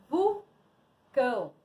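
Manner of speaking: slow, split "vulcão"; syllable-by-syllable reveal. Shown as a demonstration. Teacher revealing a word to pupils, syllable by syllable. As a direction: separate the two syllables for learners.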